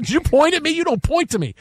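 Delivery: high, indignant scolding; scolding wife. Retold or imitated. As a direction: scold him sharply.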